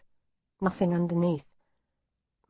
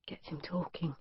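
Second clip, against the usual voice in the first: inner whisper; 11 dB quieter than usual. Acted.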